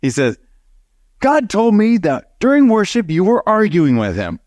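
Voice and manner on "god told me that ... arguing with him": high, pushed accusation; hammed-up scolding yell; half-mocking an angry man's rebuke; retold yelling. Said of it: "and he's yelling at me".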